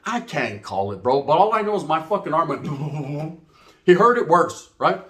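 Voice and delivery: ordinary talk, nothing marked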